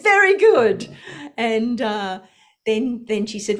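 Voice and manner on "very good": high, loud "Very good"; hearty approval. Retold or imitated. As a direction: bless it with a thigh-slapping cheer. Guru slaps thigh, approving.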